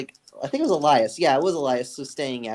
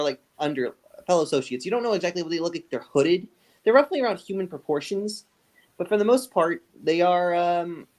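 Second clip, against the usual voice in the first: ordinary delivery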